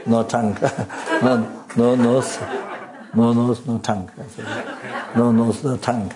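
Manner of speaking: real laugh breaking into the words. Real, not acted. Laughs while repeating the sutra's negations.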